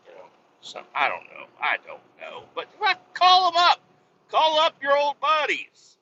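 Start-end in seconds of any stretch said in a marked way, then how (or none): 2.5-6.0 high, loud holler; loud, drawn-out rallying shout; exhorting yell. Real, not acted. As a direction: yell it high, urging everyone on. Urging listeners, shouted.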